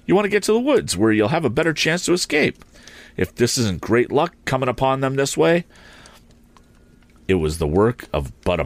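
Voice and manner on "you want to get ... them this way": excited. A narrator's reading. Tom eager in the chase.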